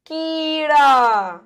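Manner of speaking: slow, stretched कीड़ा for the class; teacher drawing out कीड़ा; drawn-out model word. Shown as a demonstration. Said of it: "अब शब्द क्या बनेगा?".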